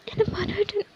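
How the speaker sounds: a broken, falling lament; despairing cry. Acted. Despair over husband. (തകർന്ന, പതിഞ്ഞ വിലാപം)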